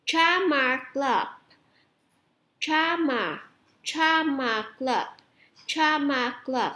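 slow, drawn-out "Drama club"; teacher slowly modelling the term; slow model pronunciation. Shown as a demonstration. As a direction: stretch each word for students to repeat. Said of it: "cô lặp lại nè".

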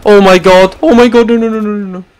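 loud, high rapid oh my god then no no no; panicked excitement. Real, not acted. Gamer caught in a firefight, loud panicked yelling. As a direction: yell in rising panic.

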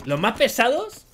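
mock exasperation. Mocking the noisy Vietnamese units.

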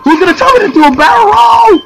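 high, loud yell of protest; wailing shout. Real, not acted. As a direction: cry it out at full pitch. Wailing over downed teammate.

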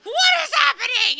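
high, loud panicked cry; panicked mom. Retold or imitated. Mom answering her daughter's call on the road, panicked shout. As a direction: shriek it like a frightened mother hearing bad news. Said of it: "goes into a panic".

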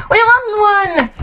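high, drawn-out cheer; excited victory cry. Real, not acted. Player celebrating a game win, high excited cheer. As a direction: celebrate with a slow, high-pitched whoop.

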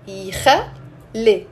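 slow, split يخلي as "eekh-le"; two syllables. Shown as a demonstration. Teacher showing two syllables, يخلي slowly sounded out. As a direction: sound out يخلي in careful beats, "yikhal-le". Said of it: "Two syllables".

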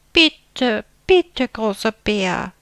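high, loud begging; pleading. A narrator's reading. Little bear begging big bear to eat upstairs, pleading. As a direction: beg sweetly for permission. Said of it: "bat der kleine Bär".

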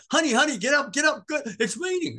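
high-pitched, urgent wake-up call; excited beloved. Retold or imitated. Beloved waking the preacher over rain, high and urgent. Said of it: "And she's like".

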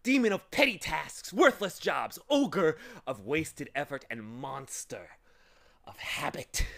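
high, booming villain's self-introduction; triumphant shriek. A narrator's reading. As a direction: proclaim your dreadful titles in triumph. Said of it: "he shrieked triumphantly".

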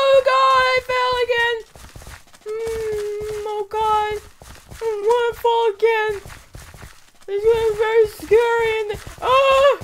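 put-on high childish whine; little kid voice. Gamer talking as a little kid while falling, high. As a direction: play a small scared kid. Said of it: "I don't even know if I sound like a little kid".

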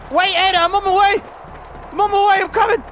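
high, loud rush to help; urgent call. Real, not acted. Gamer calling to his companion that he is on his way.

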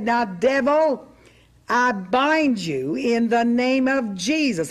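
slow, deliberate binding of the devil. Retold or imitated. Preacher slowing her rebuke so the devil understands, slow. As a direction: spell it out slowly for a foreign devil. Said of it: "I thought I'd talk a little slower".

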